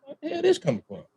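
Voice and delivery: high, startled mock outburst; surprised exclamation. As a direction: exclaim it like you've just spotted the damage.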